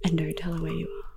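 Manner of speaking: hushed aside so the caller can't hear; whisper. Real, not acted. Whispering a secret instruction to her friend during the prank call.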